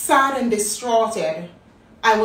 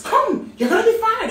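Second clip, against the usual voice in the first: much higher, louder furious order; angry boss; 12 semitones higher, 4 dB louder than usual. Retold or imitated. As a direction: snap the order like a raging supervisor. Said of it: "he says".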